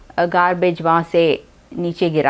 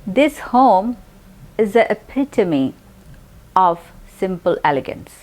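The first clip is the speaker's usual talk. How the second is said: slow, clear reading pace; slow example sentence; much slower than usual. Shown as a demonstration. Teacher reading an example slowly. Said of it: "example में देखते हैं".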